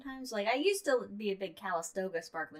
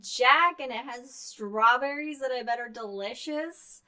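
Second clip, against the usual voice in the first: raised teasing jealous tone; playful mock envy; 6 semitones higher, 7 dB louder, slower than usual. Real, not acted. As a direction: tease him about the garden.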